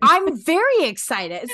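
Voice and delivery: loud, high burst of enthusiasm; gushing, emphatic excitement; very excited. Real, not acted. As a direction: prove how excited you are. Insists she's excited after tease.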